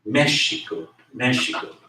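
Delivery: Mexico as MESH-ee-ko; early pronunciation. Shown as a demonstration. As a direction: say "Mexico" with sh, first syllable stressed. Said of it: "the early pronunciation for Mexico".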